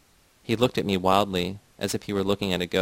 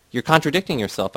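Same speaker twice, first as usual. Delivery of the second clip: higher-pitched, quick-to-object narrated retort; mild exclamation; 5 semitones higher, 4 dB louder than usual. A narrator's reading. Narrator catching Titus in contradiction, mildly exclaimed. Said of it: "I exclaimed".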